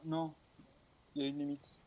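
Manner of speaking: ordinary delivery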